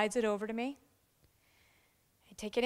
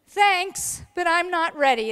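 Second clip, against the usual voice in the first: laughing refusal; 6 semitones higher, 8 dB louder than usual. Retold or imitated. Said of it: "is exactly what she said".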